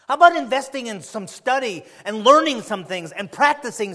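fervent sermon appeal; preaching exhortation. Real, not acted. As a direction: urge the flock to invest.

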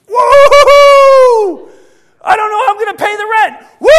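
joyful mock whoop; carefree whoop. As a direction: whoop like you don't have a care. Acting carefree secure believer.

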